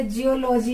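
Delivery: जियोलॉजी as jiyo-LOH-jee; mispronounced जियोलॉजी. Shown as a demonstration. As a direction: keep लॉजी a separate unit in जियोलॉजी. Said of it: "जियोलॉजी नहीं". (जियोलॉजी को जियो-लोजी तोड़कर)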